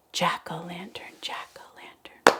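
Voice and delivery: unvoiced chant whisper. Shown as a demonstration. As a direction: whisper the word softly. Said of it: "Can we say that together".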